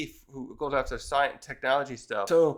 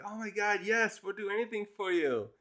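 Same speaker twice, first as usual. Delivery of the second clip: hushed, higher-pitched mock swooning; smitten admirers; 5 semitones higher, 5 dB quieter than usual. Islanders promising to do anything for her, smitten. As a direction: imitate besotted fans, soft and high.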